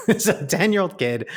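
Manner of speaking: real laugh through the words. Real, not acted. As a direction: crack up at the absurdity. Laughing at his own story.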